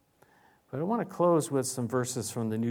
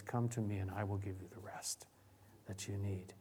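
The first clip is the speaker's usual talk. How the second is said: tender invitation; 5 semitones lower, 12 dB quieter than usual. Acted. Tender invitation voicing Jesus.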